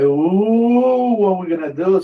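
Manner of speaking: long swooping loud "ooh", high; mock panic. Comrade winding up the new guy, loud mock panic. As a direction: wail it like a teasing buddy. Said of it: "this guy just fucking with me".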